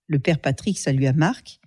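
ordinary delivery

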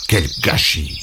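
low, soft grumbling mutter. Acted. Léon turns his back on the pig, muttering low. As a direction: grumble it under your breath. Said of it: "en marmonnant".

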